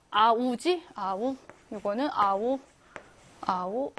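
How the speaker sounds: usual speaking voice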